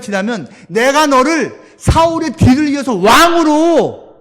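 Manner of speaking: God shouting down at a wayward David; scolding God. Retold or imitated. 하나님이 다윗을 꾸짖음. (다윗을 꾸짖는 하나님의 높고 큰 목소리)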